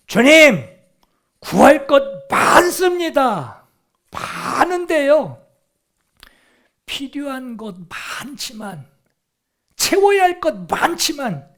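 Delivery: high, drawn-out cry in prayer; loud pleading. Acted. Blind man tells the Lord his many needs, loud pleading.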